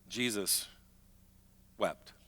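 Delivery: solemn hush. Real, not acted. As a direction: let the verse fall quietly. Solemn verse read slowly.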